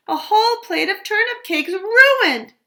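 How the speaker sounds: high, loud wail of dismay; dismayed cry. Acted. Goldie wails over the ruined turnip cakes. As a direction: lament the ruined plate loudly. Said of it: "Goldie cried".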